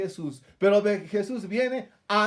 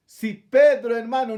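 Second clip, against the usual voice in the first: forceful; 3 semitones higher, 8 dB louder than usual. Real, not acted. Preacher stressing a key point.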